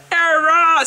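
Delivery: sudden high Era!, loud realisation; Era! blurted out high and loud; correcting herself. Real, not acted. Vlogger catching her own mistake, exclaiming high. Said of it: "See, this is what I'm saying".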